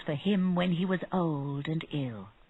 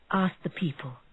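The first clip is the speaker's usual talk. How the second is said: usual speaking voice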